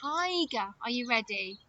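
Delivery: ordinary delivery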